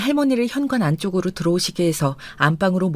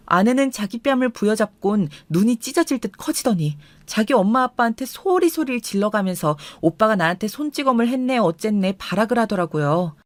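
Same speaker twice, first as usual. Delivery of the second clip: ordinary delivery, nothing marked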